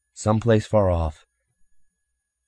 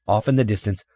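usual speaking voice

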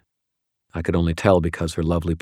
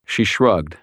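usual speaking voice

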